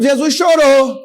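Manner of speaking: loud, high proclamation; emphatic shout. Real, not acted. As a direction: hammer the point home. Preacher's climactic point.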